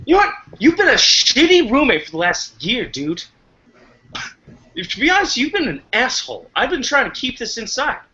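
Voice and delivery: high-pitched, pent-up roommate rant; angry outburst. Acted. Cook to roommate after the cake is dropped, angry outburst. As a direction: let long-held resentment burst out. Acted blow-up between roommates.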